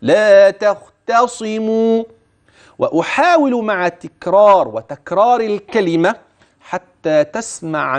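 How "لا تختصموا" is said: slow chant of لا تختصموا, thin ت, thick خ and ص; light tāʾ, heavy khāʾ. Shown as a demonstration. Teacher demonstrating light and heavy letters in لا تختصموا. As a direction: lighten each ت and weight the خ in لا تختصموا. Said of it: "التاء مرة ثانية".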